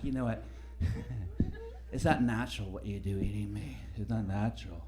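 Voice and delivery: quiet, low preachy vegan voice; lightly voiced scolding vegan; mock vegan woman. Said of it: "She's like".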